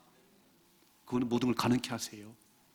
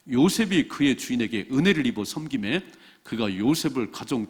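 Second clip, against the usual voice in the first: slow, loud congregational Bible reading; scripture reading; 4 dB louder than usual. Real, not acted. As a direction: read scripture out loudly in measured phrases.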